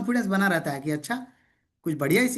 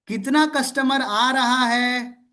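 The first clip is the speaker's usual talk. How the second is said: slow, louder repeat-after-me line with कितना stressed; slow loud drill; 3 semitones higher, 4 dB louder, much slower than usual. Shown as a demonstration. Coach drills the question so the class shouts along, slowly. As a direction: lead the class, stretching every word. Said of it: "जोर से चिल्ला के बोलिए".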